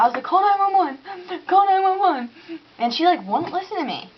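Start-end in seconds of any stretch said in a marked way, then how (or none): 0.2-2.3 high, urgent repeated plea; imitating a frantic cry for help; panicked call. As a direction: beg someone to call for help.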